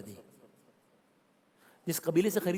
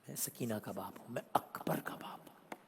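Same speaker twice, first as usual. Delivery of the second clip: sorrowful whisper; 4 semitones lower, 10 dB quieter than usual. Retold or imitated. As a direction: let the names dissolve into a whisper. Imam tells children of his own.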